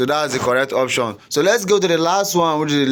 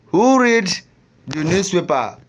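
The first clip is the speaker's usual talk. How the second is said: "read" hoog en luid uitgeroepen; stress on read; 4 semitones higher than usual. Shown as a demonstration. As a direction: zet vol accent op "read", hoger en luider. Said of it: "Read, which is emphasized word".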